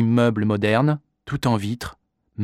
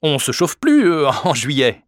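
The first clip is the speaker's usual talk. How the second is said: higher, louder defensive reply; slightly vexed, hesitant; 5 semitones higher, 6 dB louder than usual. A narrator's reading. Father defending his barge to the lady, slightly vexed. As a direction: answer a bit offended, faltering midway. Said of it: "Papa hésita un, un peu vexé".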